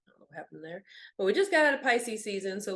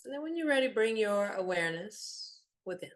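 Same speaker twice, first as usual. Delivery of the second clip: softer meditation voice with long pauses; guided meditation; 3 dB quieter than usual. Real, not acted. Guide leading listeners through a body scan, calm meditation voice. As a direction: guide them gently inward, leaving long silences.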